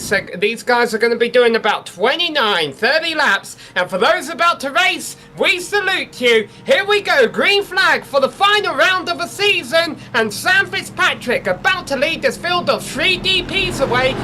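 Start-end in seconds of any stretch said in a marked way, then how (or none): 3.7-14.1 excited race call. Real, not acted. Race start call, green flag.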